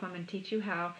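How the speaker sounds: ordinary delivery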